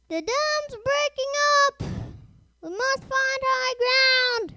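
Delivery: high, loud warning shout; urgent cry, pitched right up; shouted alarm. A narrator's reading. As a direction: shout the danger out to everyone. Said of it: "shouted Toby's driver".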